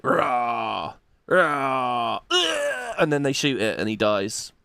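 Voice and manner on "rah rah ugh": mock battle grunts. Fight noises voiced.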